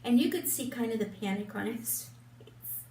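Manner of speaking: usual speaking voice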